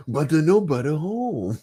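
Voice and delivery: playful Cajun rogue impression; Gambit impression. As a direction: ham up Gambit's Cajun drawl. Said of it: "Gambit is still one of the funnest voice".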